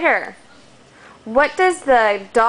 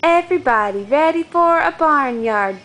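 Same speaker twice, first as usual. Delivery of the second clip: put-on reading to a toddler; 5 semitones higher than usual. Reads a picture book to toddler.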